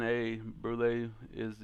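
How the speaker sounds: ordinary delivery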